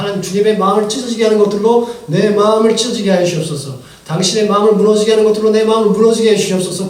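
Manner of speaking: earnest loud plea to God; fervent prayer. Retold or imitated. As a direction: pour it out like loud corporate prayer. Voices his fervent prayer to God.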